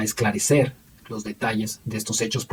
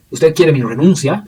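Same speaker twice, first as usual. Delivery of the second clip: louder, higher, rising on RENUNCIA; retold pointed question; 5 semitones higher, 7 dB louder than usual. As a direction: put it as a challenge, voice lifting into RENUNCIA. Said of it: "le preguntó".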